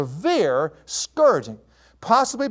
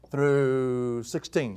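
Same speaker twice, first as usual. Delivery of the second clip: very slow, lower, stretched THROUGH before sixteen; drawn out; 5 semitones lower, 3 dB quieter, much slower than usual. Real, not acted. Preacher giving the verse range, drawing out "through". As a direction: stretch "through" right out, then land "sixteen".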